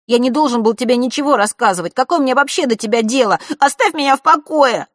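loud, high angry yell; explosive outburst. A narrator's reading. Брат взрывается на сестру, кричит в ярости. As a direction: blow up at her all at once. Erik keeps yelling at sister.

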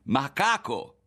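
high, loud jeer; sling the insult with relish; smug insult. A narrator's reading. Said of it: "riprese con aria di compiacimento".

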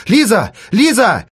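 high, loud cries of the name; shouting. Acted. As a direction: yell the name across the street. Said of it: "Она уже кричала". (громкий выкрик имени)

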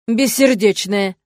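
slow, loud, stretched-out reproach; mock indignation. A narrator's reading. Demon teases the witch with mock indignation. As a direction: drag the word out in playful outrage. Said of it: "возмутился Дамьен".

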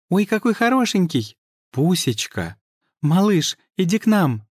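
put-on high cooing baby talk. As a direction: coo at it like a cute pet. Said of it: "хором засюсюкали".

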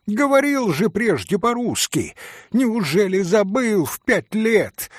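high, furious rebuke; angry. Acted. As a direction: scold him in anger. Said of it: "вся покраснела от гнева". (Грушенька в гневе, высокий голос)

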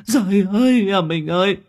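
keening cries, voice cracking high; wailing lament. Acted. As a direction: howl your grief over his body. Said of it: "mà gào lên".

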